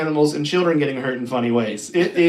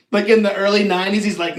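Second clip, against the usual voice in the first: ordinary talk, nothing marked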